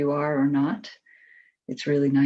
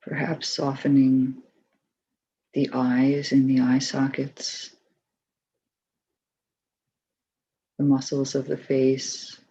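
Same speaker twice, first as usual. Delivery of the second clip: calm, even voice with long pauses; unhurried, settled meditation leader's delivery; guided meditation. Acted. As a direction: guide them gently through the body, leaving space between phrases.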